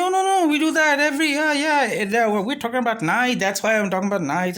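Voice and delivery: high, hurried mock backpedalling; protesting seller. Seller explaining himself to the customer, flustered protest. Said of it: "And he's like".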